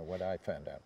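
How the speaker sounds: normal talking voice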